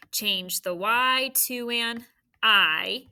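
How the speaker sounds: "Y to an I" spelled out very slowly; slow rule. Shown as a demonstration. Teacher to third grade, slow stressed rule. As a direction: stretch "Y to an I" so the rule sinks in. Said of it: "so what do we do?".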